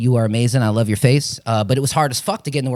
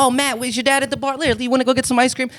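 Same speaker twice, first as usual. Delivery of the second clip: higher, coaxing lady's voice; sweet streetwalker voice; 12 semitones higher, faster than usual. Retold or imitated. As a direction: sweet-talk a kid walking alone at night. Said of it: "they used to be like".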